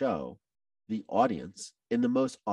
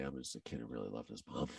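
real emotion, softer and lower, almost a whisper; choked up; 9 semitones lower, 15 dB quieter than usual. Real, not acted. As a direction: let the feeling close your throat as you whisper it. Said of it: "'cause now I'm gonna get choked up again".

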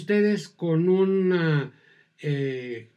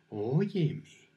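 soft, suggestive, falling; hint at something, coaxing and soft; insinuating; 3 semitones lower, 6 dB quieter than usual. Shown as a demonstration. Teacher demonstrating an insinuating tone, soft.

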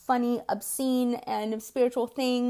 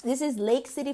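ordinary talk

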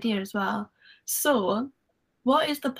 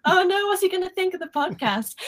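panicked; 9 semitones higher than usual. Retold or imitated. Retelling her panic before recording.

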